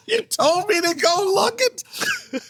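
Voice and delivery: high, slow, laughing through the words; laughing protest. Retold or imitated. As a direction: crack up while pleading.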